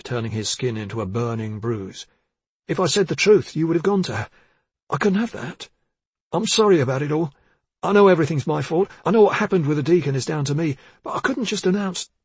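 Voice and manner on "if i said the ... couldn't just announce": higher, strained defensive voice; pleading, guilty young man; anxious young constable. Acted.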